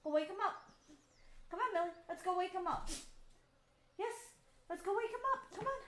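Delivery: rile up the dog; excited dog talk. Retold or imitated. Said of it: "I could easily say".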